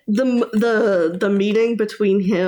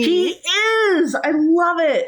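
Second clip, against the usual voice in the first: gushing; 9 semitones higher, slower than usual. Real, not acted. Gushing over a character.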